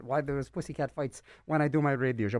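usual speaking voice

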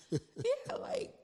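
giggly high-pitched yeah; laughing squeal. Retold or imitated. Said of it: "Like, I was like".

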